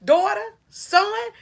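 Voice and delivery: voice of God. Acted. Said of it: "God gonna say".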